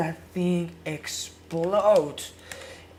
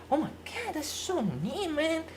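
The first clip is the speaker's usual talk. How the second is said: delighted gasp; 10 semitones higher than usual. Acted. Sudden delighted reaction in sketch.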